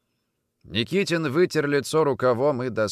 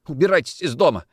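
higher, distressed order to leave; tearful outburst; 6 semitones higher, 4 dB louder, faster than usual. A narrator's reading. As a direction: drive him out through tears. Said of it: "плакала жена".

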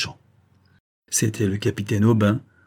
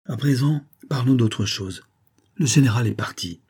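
ordinary delivery, nothing marked

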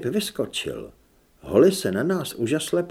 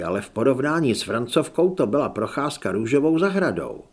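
ordinary delivery, nothing marked

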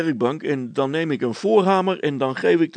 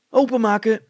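louder, higher eager cry; calling out; 7 semitones higher, 6 dB louder than usual. A narrator's reading. Hannes wants the box opened, calling out louder and higher. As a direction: urge it loudly. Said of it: "riep Hannes".

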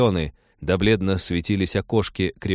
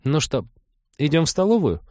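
higher, cheery invitation; rally the guests cheerfully; upbeat, higher-pitched offer; brisk suggestion; 8 semitones higher than usual. A narrator's reading. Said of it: "бодро предложила она".

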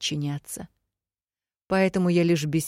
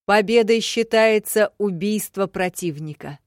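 loud, raised announcer's voice; formal proclamation; 5 semitones higher, 8 dB louder than usual. A narrator's reading. Secretary announces the duel rules to the hushed crowd, proclaiming loudly. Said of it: "возвестил секретарь в тишине".

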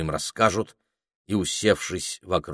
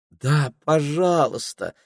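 pleading; 7 semitones higher, slower than usual. Acted. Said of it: "умоляюще попросила Пенелопа".

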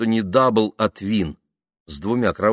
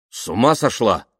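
higher, louder, but held-back exclamation; restrained shout; 4 semitones higher, 6 dB louder than usual. Acted. Man refuses woman asking to lie beside him, restrained shout. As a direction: cry out without really yelling. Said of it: "негромко крикнул он".

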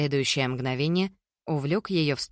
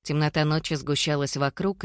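usual speaking voice

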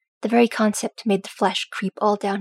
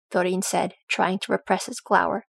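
ordinary delivery

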